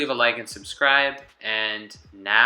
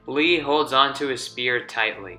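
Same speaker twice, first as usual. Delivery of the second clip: ordinary delivery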